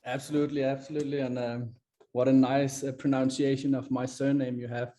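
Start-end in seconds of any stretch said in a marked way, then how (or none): none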